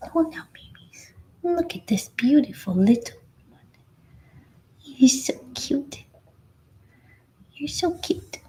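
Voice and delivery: whispered coaxing to a newborn pup. Real, not acted. Cooing to puppy.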